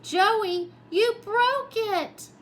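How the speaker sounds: high, loud and slow, dismayed; upset cry. A narrator's reading. Joey crying out to his friend about the broken toy. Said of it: "Clark cried".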